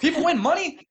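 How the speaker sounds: high, loud disbelieving question; re-enacted wide-eyed shock; astonished. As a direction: squeak it out in amazement. Retelling astonishment at prize money.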